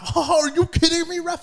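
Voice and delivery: high, sneering laugh at the ref; mocking laugh. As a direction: laugh it off like a jeering heckler. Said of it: "he would, like, laugh".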